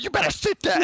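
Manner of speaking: high, loud mock scolding; imitated wife's sharp high command; bossy wife. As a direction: order him down like a fed-up wife. Wife bossing her husband.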